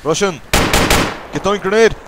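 grenade warning yell. Real, not acted. Warning squad of enemy grenade.

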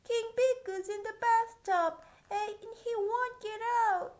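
high loud worried cry; panicked page. Acted. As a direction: wail it like a frantic page. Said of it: "cried the page".

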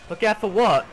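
normal talking voice

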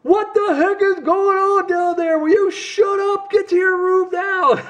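high, slow mock yelling parent; hammed-up parent shouting downstairs; angry parent. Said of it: "you want your parents to kinda be like".

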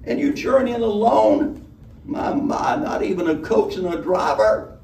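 high, brassy, coaxing tavern woman; Honeybell. A narrator's reading. Honeybell pressing the guest for his name.

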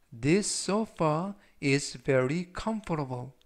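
very slow, every word spelt out for learners; slow read-aloud. Shown as a demonstration. Teacher reading a model sentence slowly for students to repeat. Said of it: "제가 역시 천천히 세 번씩 읽어드리고요".

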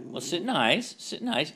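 high, sing-song sit; high pet command. Real, not acted. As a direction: coax her to sit nicely.

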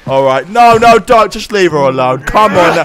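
ordinary delivery

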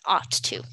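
"ought" said with a clear ah vowel; ah sound in ought. Shown as a demonstration. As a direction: model "ought" slowly as "aht". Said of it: "It's like an ah".

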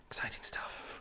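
breathy mock whisper of excitement. Streamer whispering to viewers, a mock hushed excitement. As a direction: hush it like a secret thrill.

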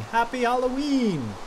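high, drawn-out Halloween cheer; cheery greeting. Acted. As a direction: sing out the greeting slowly and high. Reading a fan's greeting.

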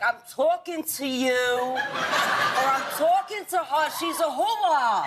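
hammed-up Bronx accent, slow and drawn out. Brooklyn friend teaching the host a Bronx accent. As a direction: lean hard into the Bronx vowels, laughing. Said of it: "and I was like".

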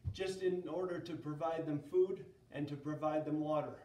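ordinary talk, nothing marked

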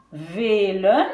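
VELEN avec le premier E étiré; E long. Shown as a demonstration. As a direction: dire «vee-len», voyelle tenue. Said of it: "je la prononce long". (VELEN, première voyelle longue)

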